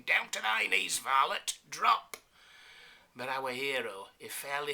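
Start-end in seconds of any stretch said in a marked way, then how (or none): 0.0-2.2 loud mock-archaic sergeant's shout; louder sergeant bellowing the order. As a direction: shout the command while swinging the axe.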